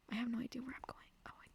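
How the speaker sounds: very soft whisper to oneself; whispered aside. Real, not acted. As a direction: drop into a barely voiced aside. Muttered aside about being lost.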